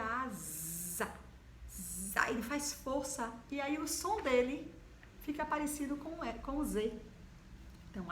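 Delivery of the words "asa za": asa, then za with a buzzing z; stressed za sound. Shown as a demonstration. Teacher isolating the z in asa for pupils. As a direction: split asa to show the z. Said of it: "a mesma coisa".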